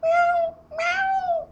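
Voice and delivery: very high imitated meows; panicking cat. Cat on windowsill sees owner locked out, panicky meowing impression. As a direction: mimic a frantic cat, squeaky high. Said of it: "She's, like, panicking".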